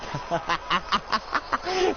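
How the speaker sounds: high-pitched mad chuckling; villain's laugh. Acted. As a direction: laugh madly as you hug him. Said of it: "he's just like".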